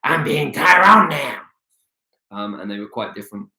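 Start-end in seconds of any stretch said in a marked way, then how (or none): 0.0-1.4 higher, slower, lightly evil puppet voice; a sly, sinister hand-puppet, drawn out; a touch of an evil puppet, slow and raised; Tyrone puppet voice. Shown as a demonstration. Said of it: "and then I'd be like this".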